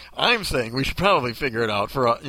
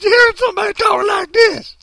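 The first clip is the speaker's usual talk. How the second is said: loud, very high cartoon maid; hammed-up hollering old-lady voice; put-on maid voice; 7 dB louder than usual. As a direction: wail it up high in mock outrage. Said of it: "the maid from Tom and Jerry".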